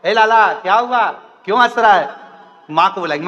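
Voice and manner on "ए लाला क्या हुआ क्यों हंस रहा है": put-on higher, louder parent calling the child; worried parent. Parent asks the laughing baby why, louder and higher. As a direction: call to the baby, puzzled.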